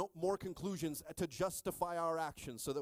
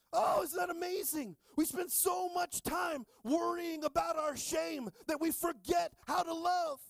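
high, loud, shouted preaching; full-force preaching; 12 semitones higher, 4 dB louder than usual. Acted. Pastor preaching to the congregation about shame, loud and high.

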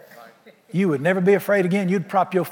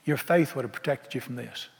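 soft rebuke; 6 semitones lower, 8 dB quieter than usual. Acted. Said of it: "he says".

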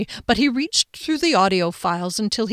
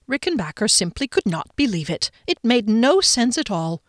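ordinary delivery

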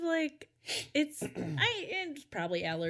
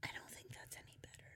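very soft, unvoiced whisper; barely audible; 18 dB quieter, faster than usual. Real, not acted. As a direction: whisper it far too quietly to catch. Too quiet to be heard.

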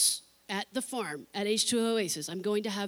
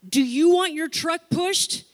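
raised higher voice, loud and slow over road noise; calling out over traffic; 6 semitones higher, 11 dB louder, slower than usual. Retold or imitated. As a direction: call out so he hears you over the cars.

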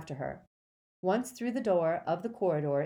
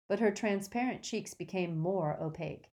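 usual speaking voice

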